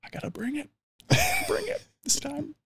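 high, soft self-pep; hyping myself. Retold or imitated. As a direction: psych yourself up. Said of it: "you're like".

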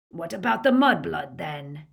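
lightly growled question; rough henchman cutting in, loud at first; a touch of a growl; Greyback's growl. A narrator's reading. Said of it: "growled Greyback".

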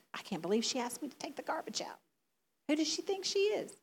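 hushed, offended muttering that rises on the question; indignant mutter. Acted. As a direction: grumble under your breath, affronted. Acts out an offended listener.

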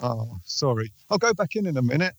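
usual speaking voice

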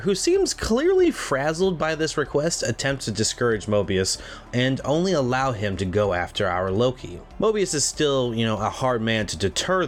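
steady scripted recap read; recap narration. Acted. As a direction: read the plot summary like a host. Podcast host reads episode recap.

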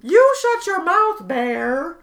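high, loud parody of the line; hammed-up mock line. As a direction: overplay it mockingly. Mocking the line back.

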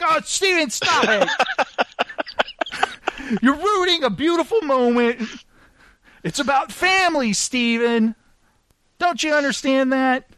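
mock tearful. Mock-sentimental scolding of friend.

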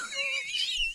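high, breathy laugh; real high-pitched laughter. Real, not acted. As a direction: crack up at your own story. Cracks up at own punchline.

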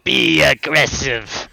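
slow, drawn-out command; slow emphatic command. Retold or imitated. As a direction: lean into it like a drill instructor. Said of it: "repeating the range instruction as a performance".

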